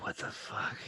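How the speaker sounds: whispered, very soft aside; under his breath. Acted. Player voices his character reacting to the group, under his breath. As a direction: whisper it privately, barely audible. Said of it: "Under his breath, Eno's like".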